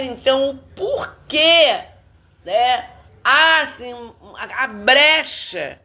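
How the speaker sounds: slow, drawn-out preaching, rising high on key questions. Real, not acted. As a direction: announce the sermon theme with weight. Opens the sermon theme.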